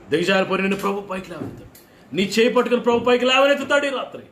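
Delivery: high, loud fervent preacher's cry; preaching at full force. Acted. Pastor crying out to the congregation, high and loud. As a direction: call out to the congregation with fire.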